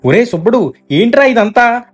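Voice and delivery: gasping disbelief at the sight; ఆశ్చర్యంగా, గొంతు పైకి లేస్తూ; astonished. A narrator's reading. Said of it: "ఆశ్చర్యపోయారు".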